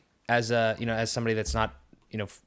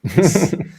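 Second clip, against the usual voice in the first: loud laugh; 7 semitones higher, 13 dB louder than usual. Real, not acted. Laughing at chat being lost.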